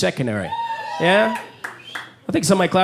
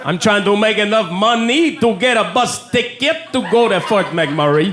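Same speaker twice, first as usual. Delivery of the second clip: put-on French Canadian accent. Panhandler voice continued.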